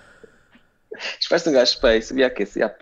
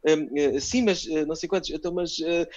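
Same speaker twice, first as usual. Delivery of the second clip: hesitant anchor; 5 semitones higher than usual. Retold or imitated. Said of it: "pivô a perguntar ao gajo".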